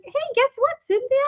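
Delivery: high cartoon kid, sweetly setting up a jab; D W's happy smile. Cartoon kid baiting visiting friend, happy-smile voice. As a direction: imitate a kid's sing-out question through a smile. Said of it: "And she's like".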